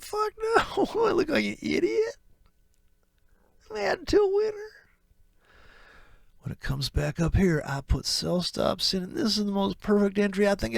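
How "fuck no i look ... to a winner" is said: very high, smug brag; cocky trader. Acted. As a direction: squeak it out proudly.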